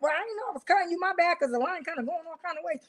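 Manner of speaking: high, soft, falsely innocent apology; mock innocent. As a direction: play dumb sweetly. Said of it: "I'm like".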